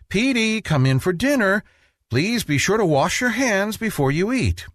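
a hint of a mother calling. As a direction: call him in for lunch. Mother calls child.

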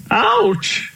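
sudden high ouch; high yelp of an old elephant; pained cry. Acted. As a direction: yelp it as the creaks bite. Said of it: "cried Uncle Elephant".

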